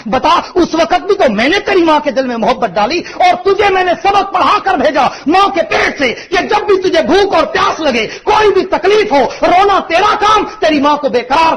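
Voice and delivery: climbing high and loud, full pulpit force; impassioned preaching. Real, not acted. Preacher booming God's words to the congregation, at full force. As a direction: preach it out to the whole hall, rising to a cry.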